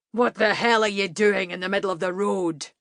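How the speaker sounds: a lightly voiced big Highland rider barking; startled rider shouts at the woman blocking the road; rough rider. A narrator's reading. Lightly voiced rider, gruff challenge. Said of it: "the largest one said".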